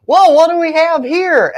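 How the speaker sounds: amused cop with a flashlight; cop finding him. Retold or imitated. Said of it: "he's like".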